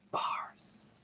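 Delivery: breathy whispered punchline. Acted. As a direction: drop to a whisper for the reveal. Dramatic hushed one-word punchline.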